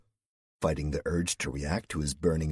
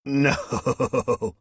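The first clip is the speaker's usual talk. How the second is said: high, louder word broken up by laughter; nervous laughing; 7 semitones higher, 6 dB louder than usual. A narrator's reading. Cal answers the woman who teased him, laughing nervously. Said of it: "with a little more nervous intensity".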